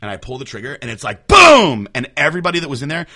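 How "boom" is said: a big, drawn-out shouted boom, much higher and louder; imitated gun blast. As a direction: blast the boom out slowly like the rifle going off.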